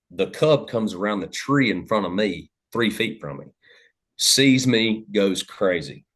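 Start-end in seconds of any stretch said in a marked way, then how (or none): none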